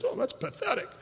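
high whispered mock scorn; breathy high-pitched scoff; secretary's scoff. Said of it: "She said".

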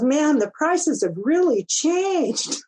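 high, slow, drawn-out marveling; mock amazement. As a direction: imitate an amazed young guy, stretching the words. Retold kid's amazed reaction.

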